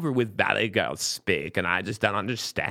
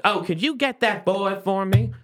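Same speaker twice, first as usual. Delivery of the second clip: put-on old lady voice; 7 semitones higher, 3 dB louder than usual. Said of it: "he's like".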